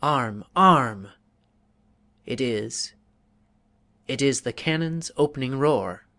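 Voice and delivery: loud urgent call to arms; declaimed alarm in verse; rousing battle cry. Acted. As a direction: sound the alarm. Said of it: "note the pauses indicated in this selection from Byron".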